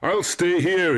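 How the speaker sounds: high, loud call across distance; shouting. A narrator's reading. Narcissus answers the unseen voice, shouting high. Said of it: "he shouted".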